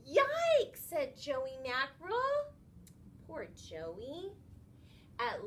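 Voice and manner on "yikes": very high, loud squeal; a small fish gets a fright; startled yelp. Acted. Joey startled by push.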